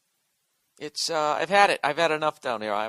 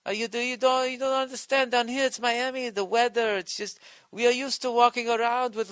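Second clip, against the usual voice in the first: much higher mock-defensive local voice; mocking Miami local; 9 semitones higher than usual. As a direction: whine the excuse like a touchy Miami girl. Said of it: "they try to defend 'em".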